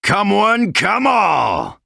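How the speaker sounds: very high, drawn-out roaring call; challenging shout. Acted. Fighter calling out to all challengers in the games, challenging shout. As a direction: bellow it to draw every challenger in.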